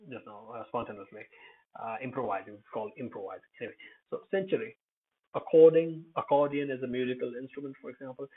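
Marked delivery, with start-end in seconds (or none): none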